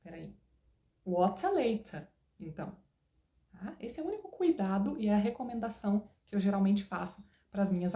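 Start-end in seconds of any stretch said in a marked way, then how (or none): 1.0-2.1 WATER and LATER both British, crisp T and no R; slow, consistent British WATER LATER; British accent, both words ending in A. Shown as a demonstration. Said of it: "tudo com a pronúncia mais britânica".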